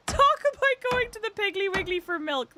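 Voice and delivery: high-pitched, helpless laughing voice. Real, not acted. Streamer cracks up over a suggested slang example, laughing. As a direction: squeeze the words out through laughter.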